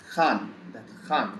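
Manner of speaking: Khan begun with a rough, aspirated "kh"; throaty kh sound. Shown as a demonstration. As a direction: say Khan as in Hindi, "khaan". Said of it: "very close sound".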